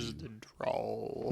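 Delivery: slow, soft mock drawl; Morgan Freeman drawl. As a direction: drag the words out slowly and softly. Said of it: "southern accent".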